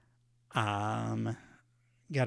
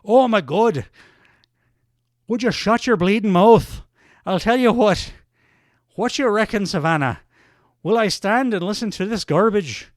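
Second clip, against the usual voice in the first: exasperated mock Irish brogue, higher and louder; Sheamus; 5 semitones higher, 5 dB louder than usual. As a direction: cut the rival off in an indignant Irish accent. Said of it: "Uh, Sheamus".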